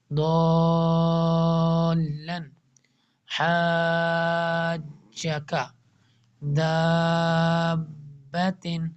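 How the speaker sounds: slow recitation, دال drawn out long; each word stretched; Quran recitation. Shown as a demonstration. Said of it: "دال کو ولڈم long کریں گے". (آہستہ تلاوت، دال لمبا)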